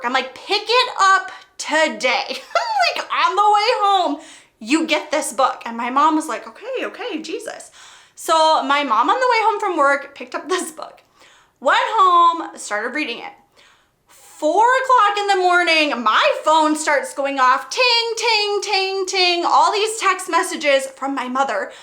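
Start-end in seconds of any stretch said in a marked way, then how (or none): 0.4-2.4 slow and firm, each word pressed; pushy daughter repeating her order to mom; insistent repeat. Retold or imitated. 6.5-7.7 soft, higher, worn-down sigh of surrender; exasperated giving in. Retold or imitated. 17.7-19.4 high sing-song ting sounds; mimicking a phone going off with texts; imitated text chimes.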